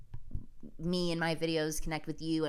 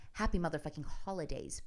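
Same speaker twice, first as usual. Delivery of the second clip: ordinary talk, nothing marked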